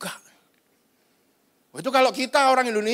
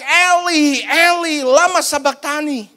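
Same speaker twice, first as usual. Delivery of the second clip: raised, drawn-out anguished call; acted cry out; 2 semitones higher, slower than usual. Jesus cries out to God on the cross, acted shout. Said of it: "sampai dia berteriak".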